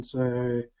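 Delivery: ordinary delivery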